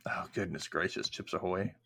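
shocked whisper. Real, not acted. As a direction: breathe it out in disbelief. Mock shock at breakfast.

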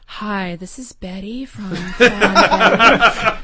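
slow, breathy-sounding seductive voice; sexy voice. Acted. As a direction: purr it like a flirty pitch. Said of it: "Do your sexy voice".

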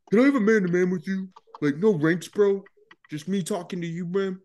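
put-on high, loud drunken appeal; imitated drunk. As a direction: plead man-to-man as a hammed-up drunk. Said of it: "I could barely stand up straight".

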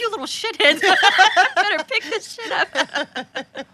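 high-pitched scolding broken up by laughter; mock-scold the kids while cracking up; laughing mock scolding. Parent jokingly scolding the kids, laughing mock scolding.

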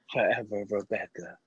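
low, soft slogan read; cologne commercial voice. Pretend. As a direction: drop low and soft like a perfume ad. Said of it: "doing a cologne commercial".

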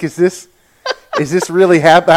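wide-eyed, high disbelief; shocked disbelief. Retold or imitated. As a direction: sound stunned. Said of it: "I'm just like".

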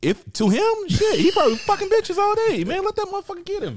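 squeaky high comic voice; high-pitched put-on voice. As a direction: push the voice way up for laughs. Joking defense of fighter's style.

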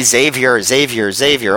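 Xavier said as ZAY-vee-er; Xavier repeated as "ZAY-vyer"; mispronounced name. Retold or imitated. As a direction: say each Xavier without the opening "ex". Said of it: "they just let him say Xavier".

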